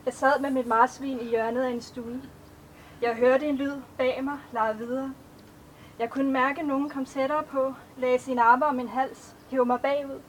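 measured, even poetry recitation; a poet reading her own verses to an audience; poem reading. Acted. Author reads her poems aloud.

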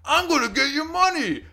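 high, loud mocking shout; mock angry shout. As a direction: yell it back at the guard, high and loud. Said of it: "this guy's like".

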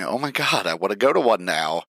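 ordinary talk, nothing marked

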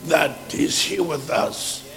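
usual speaking voice

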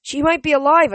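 high, loud desperate insistence. A narrator's reading. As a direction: plead urgently to be believed. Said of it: "I said desperately".

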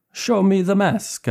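put-on absurdly high, slow Italian-accented demand; slow hammed-up Italian accent, way up high; high-pitched Italian accent. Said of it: "absurdly high-pitched Italian accented voice".

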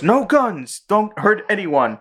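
high, drawn-out order to gunmen; mock heroic command. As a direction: imitate a hero calling out an order. Retold hero ordering gunmen.

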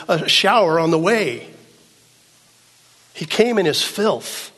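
high, loud, impassioned sermon delivery; full-force preaching. Acted. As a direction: drive it home to the congregation.

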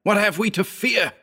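high, strained-up question; frightened cry. A narrator's reading. Narrator pleading with Howard for answers, crying out. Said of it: "I cried".

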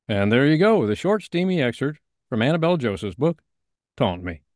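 upbeat host wrap-up; excited outro. Real, not acted. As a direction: gush about the excerpt.

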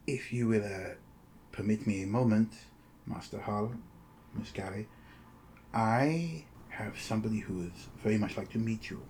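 softly spoken formal host, measured and discreet; courteous, secretive agent. Acted. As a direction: speak softly as a polite go-between. Game master voices Face Dancer.

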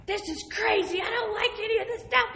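high-pitched, exasperated mock outburst; retold mad outburst. Woman retelling her reaction to the inmates' crimes, mad outburst. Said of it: "I'm just mad".

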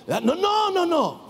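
high, shouted "no"s; emphatic rejection. Real, not acted. Preacher rejects his own feeble imitation, emphatic shout. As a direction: cut off the feeble version forcefully.

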